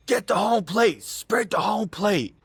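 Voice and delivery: high, drawn-out mock holler; imitated umpire yell. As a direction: bark orders like an umpire at a runner. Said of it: "the umpire starts yelling at him".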